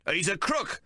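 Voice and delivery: high accusing shout. Acted. Maple shouts to the crowd, high and accusing. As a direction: yell it to the crowd. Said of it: "Maple shouts".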